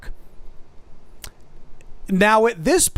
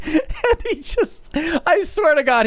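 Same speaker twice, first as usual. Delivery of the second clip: high, squeaky words through laughter; real helpless amusement; laughing while talking; 9 semitones higher, slower than usual. Real, not acted. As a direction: barely get it out for laughing. Laughing before punchline.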